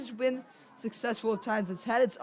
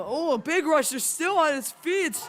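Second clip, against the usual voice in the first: higher, louder, rising excitement; excited play-by-play; 6 semitones higher, 5 dB louder than usual. Real, not acted. Commentator calling a big run, excited and loud. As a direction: call the big rush as it breaks loose.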